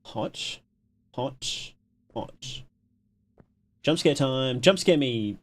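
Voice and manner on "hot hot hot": breathy whisper. Real, not acted. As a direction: whisper it under your breath. Tense moment in horror game.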